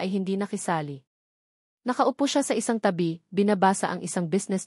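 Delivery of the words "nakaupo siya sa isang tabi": usual speaking voice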